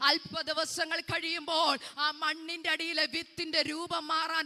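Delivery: loud, high impassioned sermon; preaching at full force. Acted. Preacher telling of weeping mothers to the church, impassioned loud preaching. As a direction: drive the image of tears home.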